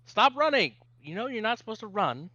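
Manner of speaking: loud, high-pitched telling-off; scolding parent. Acted. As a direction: call out sharply to a running child. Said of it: "And Elijah says".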